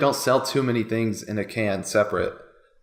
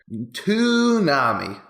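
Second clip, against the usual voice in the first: Toonami stretched slow and louder; drawn-out title reveal; 5 semitones higher, 4 dB louder, much slower than usual. Acted. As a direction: announce Toonami slowly, drawing it out. Dramatic show title reveal.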